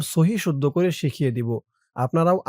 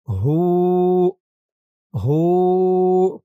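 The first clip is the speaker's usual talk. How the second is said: tajweed recitation; 3 semitones higher, 6 dB louder, much slower than usual. Shown as a demonstration. Teacher showing where to stretch each sound. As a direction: draw out ইলাহা and ইল্লা, stop on হু. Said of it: "খেয়াল করবেন কোথায় কেমন টান দেই".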